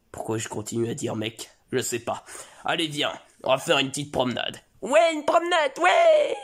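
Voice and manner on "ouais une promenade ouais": much higher, louder, ending in a squeal; excited kid cheer. Acted. Son thrilled to go for a walk with his father, high squealing cheer.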